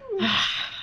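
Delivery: soft wordless sigh; exasperated sigh. Retold or imitated. Reacting to a friend's winky face, retold exasperated sigh. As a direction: let out a mock-weary breath.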